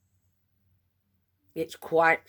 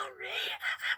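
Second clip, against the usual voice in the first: whispered giggle; 10 dB quieter than usual. Real, not acted. Laughing at embarrassing moment.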